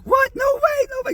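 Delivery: shrieking high disbelief; freaking out. Retold or imitated. Sister meeting [name] on the tarmac, high shrieking disbelief. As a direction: squeal it like you can't believe your eyes. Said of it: "And she's freaking out".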